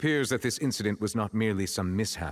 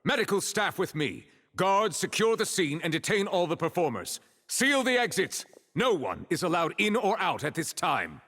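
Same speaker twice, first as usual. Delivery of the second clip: high, firm commands to the guards; commanding orders; 9 semitones higher than usual. Acted. Officer orders guards to seal the exits, commanding. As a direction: take charge of the scene, no argument.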